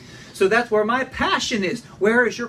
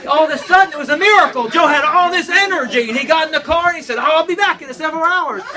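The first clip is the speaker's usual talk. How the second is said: excited storytelling; 6 semitones higher, 5 dB louder, faster than usual. Acted. Comic story climax.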